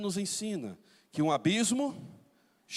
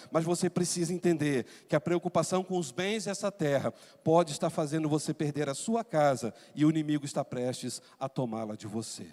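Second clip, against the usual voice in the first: normal talking voice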